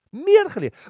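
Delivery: "Meer" hit high and loud, then falling; stress on meer. Real, not acted. Preacher to congregation, landing "Meer" with a loud high stress. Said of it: "ik heb meer geleerd uit mijn fouten".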